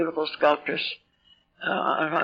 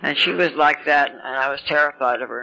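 ordinary delivery

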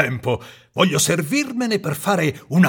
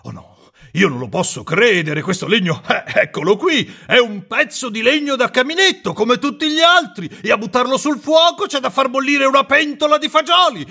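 high, shaky frightened carpenter; old carpenter scared, turning angry; trembling and stammering; 14 semitones higher, 3 dB louder than usual. Acted. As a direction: stammer at first, then work up to bluster. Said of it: "tremando e balbettando dallo spavento".

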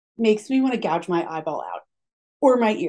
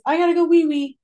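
grandpa's announcement; 7 semitones higher, 4 dB louder than usual. Retold or imitated. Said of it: "his line was".

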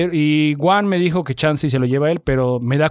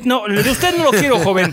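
vendor voice; 5 semitones higher than usual. Retold or imitated. Vendor refusing to buy mattress.

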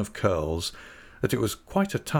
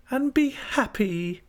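put-on very high, drawn-out girlish echo; Dora; slower than usual. A narrator's reading. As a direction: echo him slowly in a high child-wife voice. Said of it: "returned Dora".

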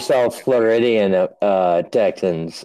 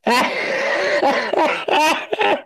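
mock Aussie rant; 8 semitones higher than usual. Host playing mock Aussie brawler.

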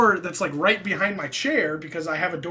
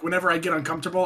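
normal talking voice

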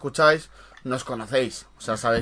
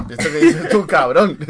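put-on louder, higher forced Colombian accent; mock Colombian accent; 3 semitones higher, 6 dB louder than usual. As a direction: imitate a friend's put-on Colombian accent, half laughing. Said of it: "con el acento colombiano ese forzado".

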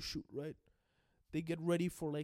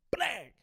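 higher, louder blang like a gunshot; gunshot sound; 7 semitones higher, 6 dB louder than usual. Retold or imitated. Teller voicing the shot that hits Kobe, a loud blang. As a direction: fire off a fake gunshot. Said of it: "I didn't have to do that blang".

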